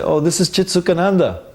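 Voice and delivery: high, eager self-introduction; enthusiastic. Retold or imitated. Young devotee introducing himself by phone, enthusiastic. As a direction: imitate your keen young self on the phone. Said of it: "Then immediately all enthusiastic".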